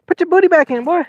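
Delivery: high, laughing scold at the fish; excited scold. Real, not acted. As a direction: yelp it at the fish, half laughing. Scolding fish on live stream.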